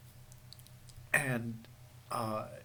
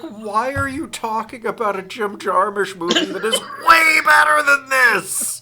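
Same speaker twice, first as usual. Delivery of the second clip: shouting; 8 semitones higher, 14 dB louder than usual. Retold or imitated. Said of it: "And I'm like".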